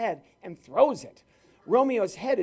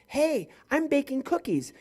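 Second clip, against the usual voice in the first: higher, put-on caller voice; complete stranger on the phone; 7 semitones higher than usual. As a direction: announce it in a higher mock caller's voice. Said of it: "A complete stranger says".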